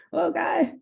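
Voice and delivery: high mock soothing voice; mock calming. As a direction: pretend to calm someone down. Mock-calming an excited man.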